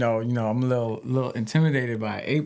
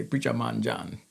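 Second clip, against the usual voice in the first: quiet Jamaican-style "preacher mon"; island accent; 3 semitones lower, 6 dB quieter than usual. Shown as a demonstration. Rapper showing what islanders say, soft Jamaican accent. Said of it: "when he go to the islands".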